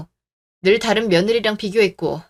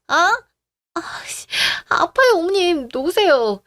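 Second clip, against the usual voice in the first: high, raised cry of pain; pained pleading; 8 semitones higher, much slower than usual. Acted. Daughter-in-law begging mother-in-law to release her hair, in pain. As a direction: beg to be let go, hurting.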